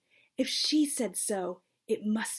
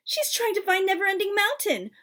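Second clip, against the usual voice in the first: much higher, louder protest; pushing back, exasperated; upset mother; 10 semitones higher, 6 dB louder than usual. A narrator's reading. Ma insists daughter will fail.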